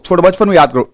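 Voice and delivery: usual speaking voice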